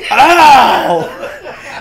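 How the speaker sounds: loud, high cry of "ow"; exaggerated yelp through laughter; mock pained yell. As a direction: howl it out, imitating the injured friend. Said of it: "And he was like".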